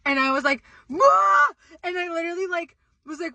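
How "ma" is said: high, loud startled yell; re-enacted panic scream. Woman retelling her stovetop fire, re-enacted panic scream. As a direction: mimic a scream at the sight of flames.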